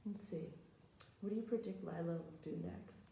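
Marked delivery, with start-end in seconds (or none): none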